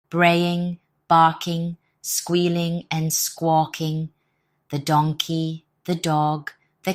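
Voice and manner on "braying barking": plain reading voice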